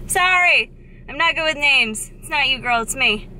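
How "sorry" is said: "Sorry!" jumps high and loud, falling at the end. Real, not acted. Vlogger apologizing to Chrissy for forgetting her name, high and loud. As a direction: blurt "Sorry!" as a bright apology.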